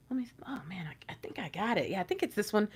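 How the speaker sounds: hushed, unvoiced whisper to oneself; whispered musing. Person weighing choices with eyes closed, whispering. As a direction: whisper as if checking something by feel. Imagined person checking by feel.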